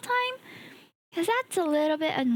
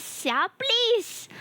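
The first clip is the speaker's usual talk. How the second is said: whiny begging, pitched up; begging chatter Xia again; mock pleading, higher; 8 semitones higher than usual.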